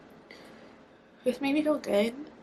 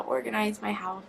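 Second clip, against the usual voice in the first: ordinary delivery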